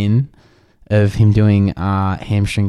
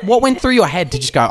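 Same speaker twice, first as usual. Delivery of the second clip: higher-pitched, incredulous questioning; retold indignant demand; 7 semitones higher than usual. Retelling his challenge to the shoe-kicker, indignant disbelief. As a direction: re-enact pressing him for his reasoning.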